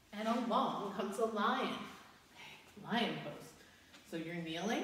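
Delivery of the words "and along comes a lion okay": usual speaking voice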